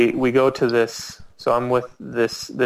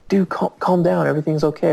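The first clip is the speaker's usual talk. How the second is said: higher-pitched hasty reassurance; placating companion; 6 semitones higher, faster than usual. Retold or imitated. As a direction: imitate a friend talking someone down. Companion tries to calm him.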